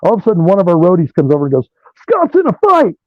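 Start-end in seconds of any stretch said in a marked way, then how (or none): 2.0-2.9 high urgent roadie's call; alarmed shout. Retold or imitated. Roadie rushing over, shouting the alarm. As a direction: yell it like a fight just broke out.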